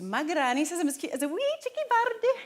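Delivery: put-on Scottish accent, loud and high, like a six-year-old; a wee Scots child's recital; Scottish child reciting her line. Shown as a demonstration. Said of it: "Can you do a Scottish accent?".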